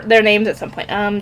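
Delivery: ordinary delivery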